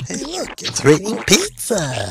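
high, whooping excitement; gleeful cartoon cheer, voice soaring; overjoyed. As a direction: crow with delight at a windfall. Rejoices at falling pizza.

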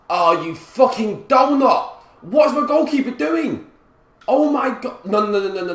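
high, loud exasperated yell; angry shout. Real, not acted. Gamer raging at his goalkeeper, angry shout. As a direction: shout in real outrage at a useless teammate.

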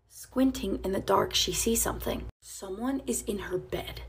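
whispered, tense storytelling; hushed narration. A narrator's reading. Narrator whispering as the girl sneaks past the intruder. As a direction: whisper as if the intruder might wake.

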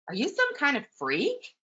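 high-pitched, disbelieving storybook question; hammed-up disbelief for young listeners; mock incredulous. Poodle reacts to Lulu.

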